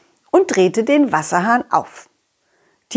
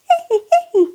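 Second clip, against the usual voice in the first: squeaky cartoon giggle; high squeaky giggle.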